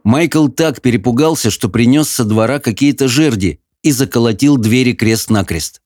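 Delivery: normal talking voice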